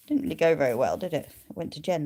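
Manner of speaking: ordinary talk, nothing marked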